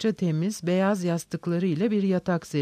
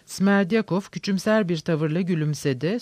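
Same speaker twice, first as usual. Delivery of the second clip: ordinary delivery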